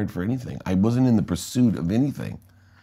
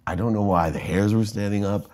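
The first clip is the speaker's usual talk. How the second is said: ordinary talk, nothing marked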